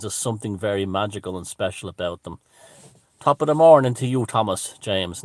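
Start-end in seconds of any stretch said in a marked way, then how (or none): 3.2-5.2 high, loud Irish top-of-the-morning hail; hammy Irish hello, starting loud and high; stage-Irish greeting. Acted. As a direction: belt out a clichéd Irish greeting. Mock stage-Irish greeting to viewer.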